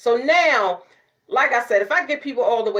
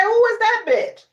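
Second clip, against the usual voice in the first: God voiced; 14 semitones higher, 4 dB louder than usual. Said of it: "He looking at you like".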